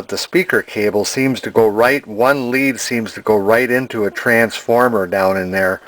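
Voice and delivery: ordinary talk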